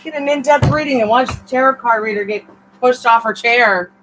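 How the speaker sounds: hammed-up loud trailer voice, pitched high; mock announcer. Reader jokes about falling off her chair, mock promo shout. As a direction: sell it like a cheesy promo title. Joking mock video title.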